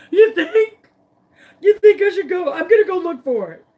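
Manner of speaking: high, loud, worked-up questioning; acted frantic reply. Upset owner answering Grace's neighborhood suggestion, frantic. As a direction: seize on the idea in a mock panic.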